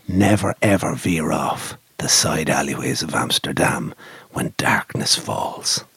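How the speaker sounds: very low, hushed warning; mock-ominous storyteller. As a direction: end the tale in a dark, sinking voice. Ominous moral closing the story.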